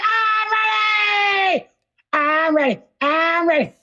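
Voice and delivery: high, slow, shouted "I'm ready"; SpongeBob voice. Retold or imitated. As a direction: drag it out like an eager sponge. Quoting SpongeBob.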